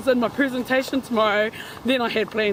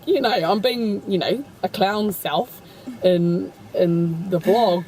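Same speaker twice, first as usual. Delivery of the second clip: ordinary talk, nothing marked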